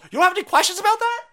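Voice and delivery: loud, high incredulous rant; furious disbelief at the outlet; incredulous outburst. Real, not acted. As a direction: demand answers in outrage.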